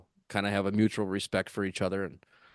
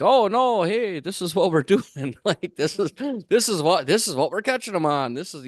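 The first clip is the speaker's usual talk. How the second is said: high, eager voice imitating Clayton; an animated Clayton, voice jumping up; excited high quote; 9 semitones higher, 5 dB louder than usual. Fisherman eagerly showing rivals his bait, excited and high.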